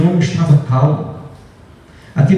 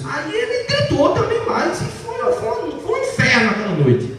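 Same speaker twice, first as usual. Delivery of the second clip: exasperated build; 11 semitones higher, 4 dB quieter than usual. Real, not acted. Escalating marital shouting story.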